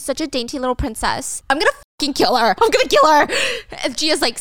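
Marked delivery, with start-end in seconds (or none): 1.5-3.3 fast then a high, loud yell on kill; mock rage, spitting out the threat; furious threat. A recapper voicing Gia's furious threat to Stacy. Retelling drama, Gia furious.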